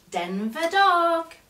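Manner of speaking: slow, high and loud reveal of the name; excited reveal. A narrator's reading. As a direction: announce the find with delight. Reveal of found toy.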